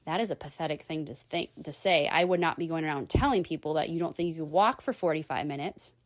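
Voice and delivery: quick, low scornful thought; inner scorn. Retold or imitated. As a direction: mutter the judgement to yourself, fast. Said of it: "but I thought".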